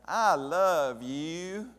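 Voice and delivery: slow, drawn-out Southern "I love you"; a loud, slow Southern-accent "I love you" for a childhood friend. Retold or imitated. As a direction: imitate a friend's strong Southern drawl, stretched long. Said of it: "she had the strongest Southern accent".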